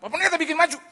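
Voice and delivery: high, loud call for help; urgent retold cry. Driver to pastor on the motorbike, urgent loud cry. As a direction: call out urgently over the ride.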